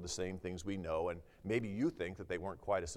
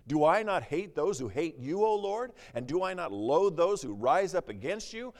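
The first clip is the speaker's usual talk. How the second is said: fiery scripture reading; 5 semitones higher, 5 dB louder than usual. Acted. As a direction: preach the psalm louder, rising to a fierce peak. Reads the psalmist's curse aloud.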